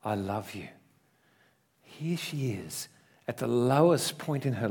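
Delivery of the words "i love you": voice of Jesus. Retold or imitated. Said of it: "The voice said to me".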